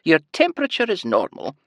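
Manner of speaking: high sing-song Scottish lilt; bright high nurse. A narrator's reading. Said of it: "a heavy Scottish accent and a high-pitched sing-song voice".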